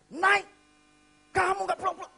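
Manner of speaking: high, very loud scolding; angry father. Retold or imitated. Father finding his son out late, furious shout. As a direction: bellow orders at a wayward child.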